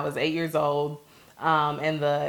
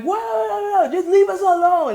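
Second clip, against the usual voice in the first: imitating brother's yell, higher and a bit louder; retold yell; 14 semitones higher, 4 dB louder than usual. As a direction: call out "leave us alone" as the angry brother. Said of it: "So I hear him yelling".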